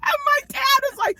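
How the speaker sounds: ordinary talk, nothing marked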